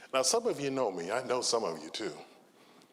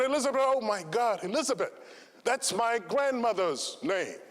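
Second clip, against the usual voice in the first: mock astonished burglar; 10 semitones higher than usual. Burglar startled by the name.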